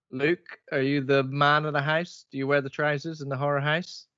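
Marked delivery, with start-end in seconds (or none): none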